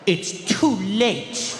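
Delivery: hammed-up wounded child; reproachful kid. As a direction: draw out the guilt trip. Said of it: "And I'd be like".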